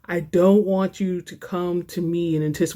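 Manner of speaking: usual speaking voice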